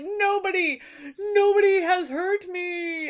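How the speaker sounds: high, crying wail of a hurt giant; whimpering giant. Retold or imitated. Blinded giant cries to neighbours.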